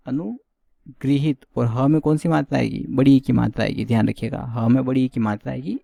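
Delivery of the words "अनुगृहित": अनुगृहित said very slowly, syllable by syllable, stressing गृ; correct slow pronunciation. Shown as a demonstration. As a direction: stretch out अनुगृहित carefully, each syllable clear. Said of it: "क्या होता है?". (अनुगृहित को बहुत धीरे, हर अक्षर साफ़ बोलिए)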